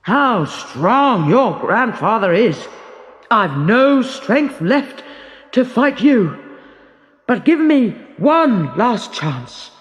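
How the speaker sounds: a limping giant crying out; battered giant begging; worn-out giant's cry. A narrator's reading. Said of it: "the giant cried".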